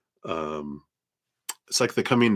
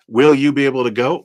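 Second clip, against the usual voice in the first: Will you be able to go? louder and quicker, rising on will; eager question; 4 semitones higher, 8 dB louder, faster than usual. Shown as a demonstration. Tarot reader asks the cards the viewer's question, loud and eager.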